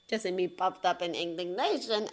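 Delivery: usual speaking voice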